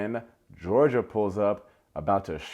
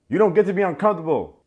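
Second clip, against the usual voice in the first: higher, louder and fast; indignant outburst; 8 semitones higher, 8 dB louder, faster than usual. Real, not acted. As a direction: snap back at her in disbelief. Scolding Francesca.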